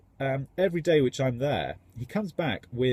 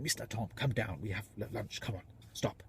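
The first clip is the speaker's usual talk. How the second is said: hushed Indian-accent impression; much quieter mock Indian accent, urging; imitated Indian accent; 2 semitones lower, 11 dB quieter than usual. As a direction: coax softly in a rough Indian accent. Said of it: "my version of his accent".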